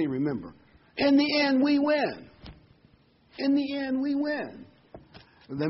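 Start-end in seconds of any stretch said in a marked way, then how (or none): 1.0-2.3 high, slow and ringing; triumphant. Real, not acted. Triumphant sermon climax. 3.4-4.7 triumphant echo. Real, not acted. Repeats the line for emphasis.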